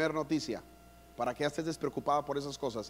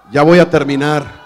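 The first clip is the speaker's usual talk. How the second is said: loud announcement; 20 dB louder than usual. Shown as a demonstration. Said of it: "ustedes gritan desesperados".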